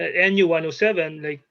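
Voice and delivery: ordinary talk, nothing marked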